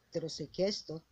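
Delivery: ordinary talk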